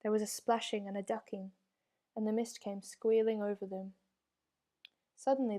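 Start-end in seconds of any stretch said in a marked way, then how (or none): none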